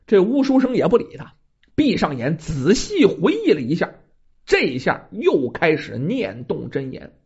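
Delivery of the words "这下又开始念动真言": ordinary delivery, nothing marked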